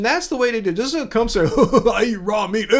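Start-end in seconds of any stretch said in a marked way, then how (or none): 1.6-2.7 hint of a dopey meat eater; dumb meat eater voice. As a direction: play a dim guy proud of eating meat. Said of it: "I was doing my dumb meat eater voice".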